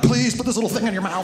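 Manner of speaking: put-on desperate parents sing-pleading with the baby; mock pleading.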